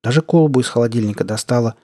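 ordinary delivery

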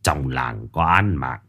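trầm, chậm, nặng nề lo lắng; báo tin dữ giọng trầm; giọng ông lão căng thẳng. Acted. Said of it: "vẻ mặt căng thẳng". (cụ Cự Lãng báo tin án mạng, giọng trầm nặng)

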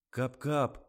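offended, indignant correction. A narrator's reading. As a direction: protest it, outraged. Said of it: "возмутился Жорик".

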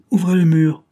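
high, loud command; barked order. Acted. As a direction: give the order sharply. Chief orders a henchman.

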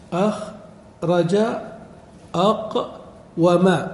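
"أخرج", "أقوم" very slow, broken after the first syllable; syllable-by-syllable demonstration. Shown as a demonstration. Teacher lining up words on one pattern, "أخرج، أقوم" split slowly. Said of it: "لأنه على وزن أفعل".

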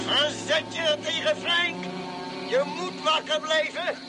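a doctor pleading over the radio, slow and urgent; urgent coaxing. Acted. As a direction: coax him to keep his eyes open. Urging a pilot who is falling asleep.

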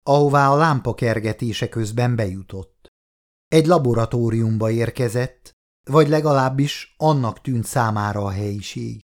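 ordinary delivery

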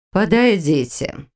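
slow, majestic command; imperious plummy grande dame. Acted. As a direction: summon them like a stately queen. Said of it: "величественно произнесла аметистовая ведьма".

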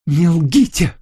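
high, loud furious cry. A narrator's reading. Lucille in a rage shouts at the narrator, furious cry. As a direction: hurl it at him in rage. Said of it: "в бешенстве воскликнула она".

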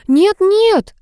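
high-pitched frantic plea; acted hysterical "no"; panicked cry. A narrator's reading. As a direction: cry out high to stop them. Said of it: "закричала Галя".

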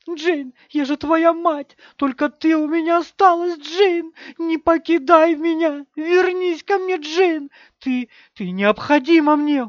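high, loud, drawn-out begging; desperate wailing. A narrator's reading. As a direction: wail as a mother begging a child to return. Мать отчаянно зовёт призрак дочери. (отчаянный высокий вопль мольбы)

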